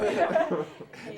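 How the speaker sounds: short, amused real laugh. Real, not acted. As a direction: laugh at a botched group recitation. Class recitation falls apart.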